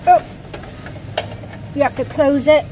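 ordinary talk

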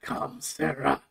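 a hushed, low god's command; a hint of a mysterious god; mock Vivek voice. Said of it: "Vivek exists".

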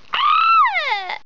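high-pitched pretend wailing; put-on sobbing wail down the phone; fake crying. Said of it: "Yeah, I'm crying".

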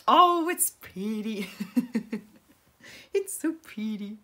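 slow, drawn-out coo of delight. Real, not acted. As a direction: coo over it with a giggle. Admiring own painting, drawn out, laughing.

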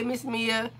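usual speaking voice

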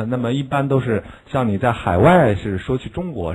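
ordinary talk

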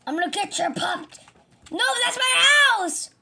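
high, loud panicked cartoon voice; frantic game character. Victim chases the thief.

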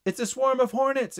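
high, alarmed exclamation; exclaiming. Acted. Tyler spotting the hornets, exclaiming. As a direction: raise the pitch and sound the alarm. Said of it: "exclaimed Tyler".

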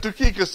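Tychicus said as too-KEE-kus; Greek pronunciation. Shown as a demonstration. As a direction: open Tychicus with an oo, stressed on KEE. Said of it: "If you say it with an accent".

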